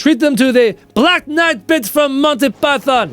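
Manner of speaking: high, hammed-up French accent. Streamer performs Monty Python for chat, mock-haughty French accent. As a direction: taunt them in a comic French accent. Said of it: "with that French accent".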